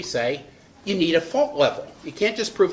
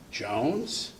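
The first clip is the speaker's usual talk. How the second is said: addressing Jones quietly; 3 semitones lower, 6 dB quieter than usual. Acted. Addressing Jones in closed office.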